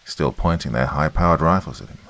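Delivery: ordinary delivery, nothing marked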